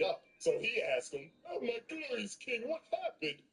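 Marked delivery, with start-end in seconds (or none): 1.5-3.4 high fawning voice; fawning servant. Retold or imitated. Treble fawns over the beaten-up boss, high fawning voice. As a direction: gush it up high like a groveling servant.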